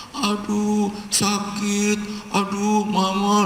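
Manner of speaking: put-on high, pained child's wail of "aduh, sakit"; acted child crying out. Preacher voices the whipped child crying to mama in pain. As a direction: cry out like a small child being whipped.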